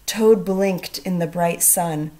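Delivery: plain reading voice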